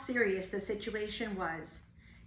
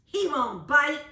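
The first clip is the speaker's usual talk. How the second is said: scoffing burglar; 4 semitones higher, 7 dB louder than usual. Acted. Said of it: "said the other".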